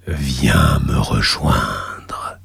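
deep, slow whispered summons; underworld god whisper. Acted. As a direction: beckon softly from the abyss. The lava god voice.

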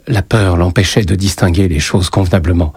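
ordinary delivery

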